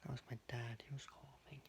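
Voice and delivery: hushed whisper. Real, not acted. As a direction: whisper so no one wakes. Whispering while others sleep.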